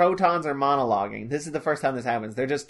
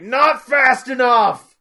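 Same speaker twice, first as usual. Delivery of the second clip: slow, loud, higher bark of a demand; impatient shout; 10 semitones higher, 9 dB louder, much slower than usual. Retold or imitated. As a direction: yell it out slowly like a furious boss. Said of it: "Forehead is like".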